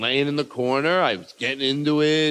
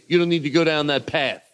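ordinary talk

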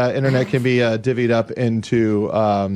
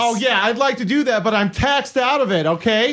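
pundit voice; 10 semitones higher than usual. Pretend. Mock pundit shouting match.

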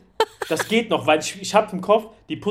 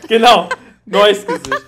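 louder, laughing through the words; 6 semitones higher, 11 dB louder than usual. Real, not acted. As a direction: talk while cracking up. Laughing recap. (laut lachend)

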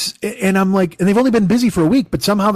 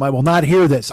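ordinary talk, nothing marked

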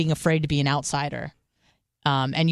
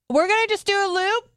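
much higher, put-on casual voice; breezy fake excuse; 12 semitones higher than usual. Guest excusing himself to slip away, breezy fake excuse. Acting out an Irish goodbye excuse.

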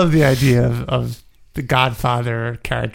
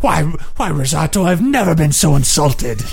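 posh indignant; 3 semitones higher than usual. Acted.

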